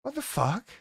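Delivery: imitating someone staring in disbelief; a mock gasp of disbelief at the screen; stunned reaction. Viewer staring at the screen, stunned disbelief.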